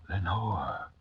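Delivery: soft, breathy whisper of a name. A narrator's reading. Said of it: "was the whispered word".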